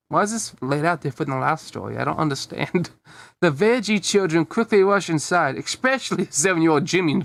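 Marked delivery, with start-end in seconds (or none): none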